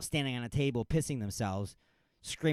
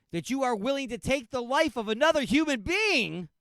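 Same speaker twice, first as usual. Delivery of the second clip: much higher, louder building rant; 11 semitones higher, 4 dB louder than usual. Real, not acted. Host ranting at ranch-crazed customers, building to a shout. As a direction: climb into a full-blown shouting rant. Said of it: "Am I on a rant here".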